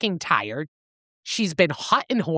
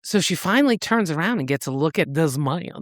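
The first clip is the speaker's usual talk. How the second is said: ordinary delivery, nothing marked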